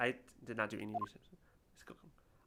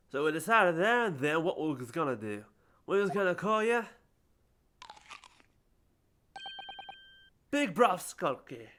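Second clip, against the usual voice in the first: louder Cockney, slowing at the name; 5 semitones higher, 4 dB louder than usual. Acted. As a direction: announce the nickname proudly in Cockney.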